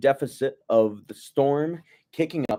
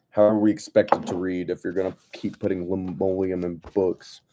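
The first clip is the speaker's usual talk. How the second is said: ordinary delivery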